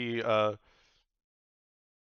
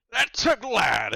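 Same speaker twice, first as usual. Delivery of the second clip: much higher, much louder, slow joyful cry; delighted shout; 13 semitones higher, 14 dB louder, slower than usual. Acted. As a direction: burst out with glee. Delighted pirate god.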